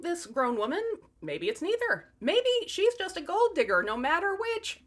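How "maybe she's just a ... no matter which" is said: playful. Real, not acted. Punchline gold digger joke.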